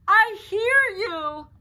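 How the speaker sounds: drawn-out booming reply; thundering dragon. Acted. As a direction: answer her with all your might. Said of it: "thundered Dragon".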